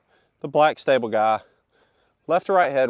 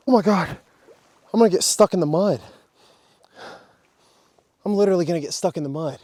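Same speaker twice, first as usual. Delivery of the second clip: panicked; 6 semitones higher, faster than usual. Real, not acted. Panics sinking in mud.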